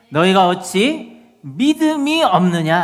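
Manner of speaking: loud, slow rebuke; rebuking. Acted. Preacher voices Jesus questioning disciples' faith, stern rebuke. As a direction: hammer the question out slowly. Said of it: "바다만 꾸짖은 것이 아니라".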